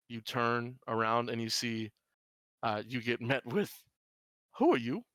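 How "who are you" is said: higher, louder demand to know; sharp challenge. Acted. Woman catching a stranger at the back door, sharp challenge. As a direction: challenge a stranger, pitch jumping up. Startled woman confronts intruder in game.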